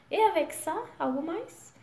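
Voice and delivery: bright, high clerk's question "avec ça"; chirpy shop clerk asking; shop assistant. Pretend. Shop clerk asking a customer, high and chirpy. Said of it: "Eles podem falar isso pra você".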